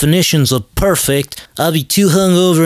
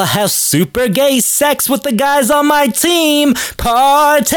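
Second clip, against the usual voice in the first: hammed-up party cheer; 8 semitones higher than usual. Hamming up outrageous party boast.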